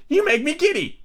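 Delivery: very high-pitched royal complaint; screamed. A narrator's reading. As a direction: screech it as an irritated queen. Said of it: "screamed the Queen".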